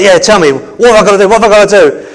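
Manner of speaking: eagerly pressing for orders; eager Joshua. Acted. Acts eager Joshua awaiting God's orders.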